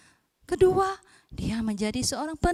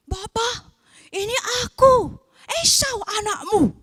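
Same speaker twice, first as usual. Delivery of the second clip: put-on higher, louder eager young son; 7 semitones higher, 7 dB louder than usual. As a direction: call out brightly to your father. Young son Esau announces himself.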